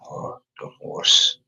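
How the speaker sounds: voiceless, spaced-out repeating to yourself; whispered to self. Real, not acted. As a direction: whisper it as you write it down. Repeating words while writing them down.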